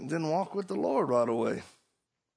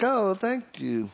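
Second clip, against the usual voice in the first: mock thanks; 8 semitones higher, slower than usual. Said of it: "I was like".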